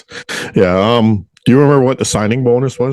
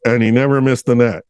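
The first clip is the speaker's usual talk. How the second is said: usual speaking voice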